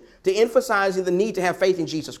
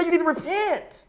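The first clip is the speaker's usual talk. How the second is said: urgent high call; 10 semitones higher than usual. Retold or imitated. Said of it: "He said".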